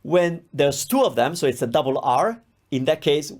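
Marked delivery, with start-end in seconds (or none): none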